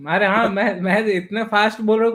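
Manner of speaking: usual speaking voice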